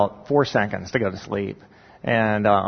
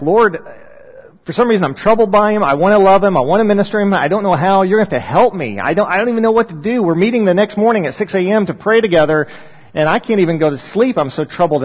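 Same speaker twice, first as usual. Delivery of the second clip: higher and louder, pleading with God; earnest prayer; 8 semitones higher, 8 dB louder than usual. Retold or imitated. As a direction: pour it out to the Lord, urgent and raised. Retold late-night prayer for Jonathan.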